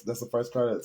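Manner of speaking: normal talking voice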